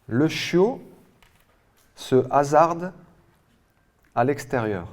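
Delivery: "Le chiot", "se hasarde", "à l'extérieur" with long pauses between; rising on "chiot", falling on "extérieur", piece by piece; chunked dictation. Real, not acted. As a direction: project "Le chiot" and "se hasarde" high and loud, pausing after each. Dictation, first reading in chunks.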